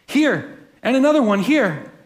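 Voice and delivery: high, distressed wail. A narrator's reading. Corrie to her sister, a mild wail of dismay. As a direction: wail in dismay over the fleas. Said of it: "Corey wailed".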